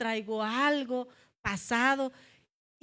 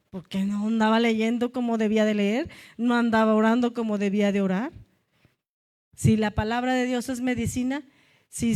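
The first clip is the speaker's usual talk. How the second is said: loud, forceful preaching confession; 5 dB louder than usual. Acted. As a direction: drive it home to the congregation.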